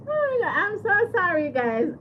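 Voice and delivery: squealing with helpless laughter; real giggles, can't hold it together; high laughing apology; laughing fit. Real, not acted. Burps on stream, laughing fit.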